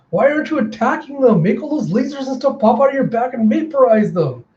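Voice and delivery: put-on very high, demanding kid voice; exasperated girl. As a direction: scold the pet in urgent high pitch. Mila exasperated at her robot pet.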